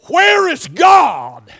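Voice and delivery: high, loud, slow question; slow shout. Real, not acted. Preacher posing the big question to the church, slow shout. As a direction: draw the question out at full voice.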